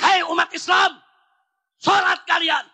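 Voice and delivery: loud, high commanding call; firm order. Shown as a demonstration. Preacher commanding believers to pray, firm loud order. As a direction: order them to pray with force. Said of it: "Itu namanya nyuruh".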